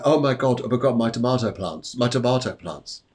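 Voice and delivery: ordinary talk, nothing marked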